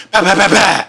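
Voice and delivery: mock gunfire bah bah; gunshot imitation. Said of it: "He went".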